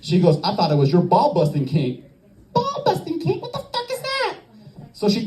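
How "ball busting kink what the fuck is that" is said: very high, strangled falsetto disbelief; mock pained squeak. Hurt man questioning her explanation, high pained squeak. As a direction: squeal it as if the boys haven't come back down. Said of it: "takes a second for the boys to come back down".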